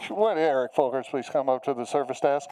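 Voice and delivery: loud, low PA voice; store microphone announcement. Retold or imitated. Store clerk paging a lost boy, loud microphone announcement. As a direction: imitate a store tannoy page. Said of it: "over the microphone".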